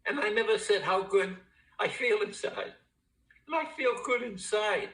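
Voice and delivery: the words crack as the feeling overwhelms him; tearful, high and strained, barely holding it together; voice breaking with emotion. Real, not acted. Emotional AA share, voice rising.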